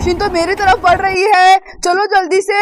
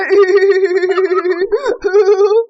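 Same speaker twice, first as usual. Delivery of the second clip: long laugh. Acted. Mechanic laughing at what's inside the washing machine. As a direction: laugh on and on at the sight. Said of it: "हंस क्यों रहे हैं?".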